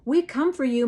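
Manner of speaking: loud, high call after the wagon; yell. A narrator's reading. Mother yells after departing wagon, loud. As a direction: shout after her. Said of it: "Mama yells".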